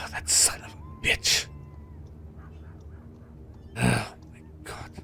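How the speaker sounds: whispered curse under the breath; a father's breathless, whispered shock; stunned whisper. Acted. Father learns daughter was thrown in.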